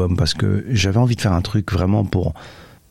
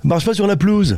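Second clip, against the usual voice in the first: high, mocking take on "pelouse" said "pluz"; mocking pronunciation; 10 semitones higher than usual. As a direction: parrot the clipped "pluz" in a high voice. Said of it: "les gens qui disent semoule". (imitation moqueuse, aiguë, de « pelouse » dit « pluz »)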